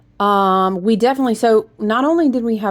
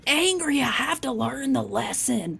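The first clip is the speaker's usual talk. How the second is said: mock angry grumble; 5 semitones higher than usual. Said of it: "and it's like".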